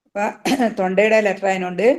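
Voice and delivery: ordinary talk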